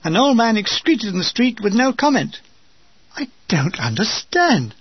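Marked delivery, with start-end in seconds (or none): 3.1-4.7 whispered, slow and baffled; puzzled whisper. Acted. Reading a friend's diary, whispering in disbelief. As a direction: breathe it out, baffled.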